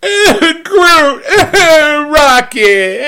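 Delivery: high, sobbing, laughing through tears; crying. Retold or imitated. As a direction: sob out the names, overcome. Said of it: "and I'm crying".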